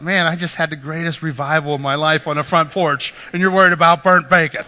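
loud, building protest; indignant retort. Retold or imitated. Retold retort to yelling family.

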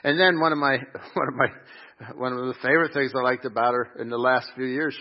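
usual speaking voice

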